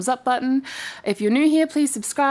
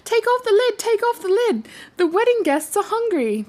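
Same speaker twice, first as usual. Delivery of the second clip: little hare voice; 11 semitones higher than usual. Acted. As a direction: sing it out like an eager little bunny.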